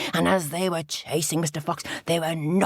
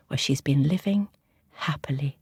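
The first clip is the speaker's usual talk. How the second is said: usual speaking voice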